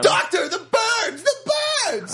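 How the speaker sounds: high, drawn-out cry of alarm; mock panic. Crying to the doctor about birds, mock panic. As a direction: cry out in hammed-up terror. Acting panicked bird-movie scene.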